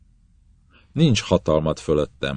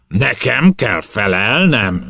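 slow, loud furious roar; captain roaring at his prisoner; roared question; 6 semitones higher, 6 dB louder, slower than usual. Acted. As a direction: bellow it at him. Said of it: "üvöltötte".

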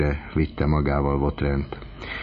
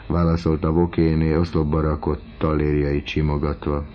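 ordinary delivery

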